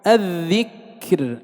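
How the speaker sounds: الذكر drawn out, ending on a thin, light ر; slow demonstration of الذكر with unvowelled light ر; light final ra. Shown as a demonstration. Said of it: "وهكذا".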